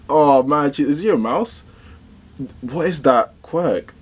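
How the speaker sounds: ordinary talk, nothing marked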